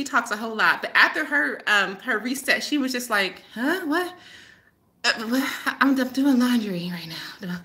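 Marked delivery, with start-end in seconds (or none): 3.5-7.6 mildly dazed, zoned-out imitation; Kathy. Student answering the phone after her reset, dazed. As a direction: trail through it as if lightly stoned. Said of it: "the way she sounded when we".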